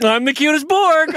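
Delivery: high, loud, laughing mock-vain boast; vain Locutus. Host imitates Locutus bragging to the Borg, laughing vanity. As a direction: play him as a preening, self-adoring Borg. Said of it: "but he's like".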